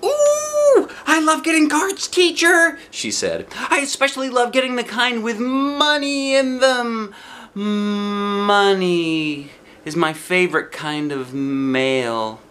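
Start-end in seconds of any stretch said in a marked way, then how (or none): 0.0-2.8 high, loud, squealing delight; squealing with glee; happy squeal. A narrator's reading. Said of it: "squealed real loud, real happy". 3.7-12.4 put-on high, sing-song rich girl; prissy little girl. A narrator's reading. Lucille's character voice.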